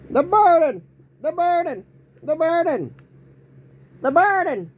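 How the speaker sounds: repeated falsetto shout, each one falling; high yell. Shown as a demonstration. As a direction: yell it high and loud, again and again. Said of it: "Let me try it now".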